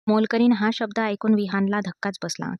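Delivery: ordinary delivery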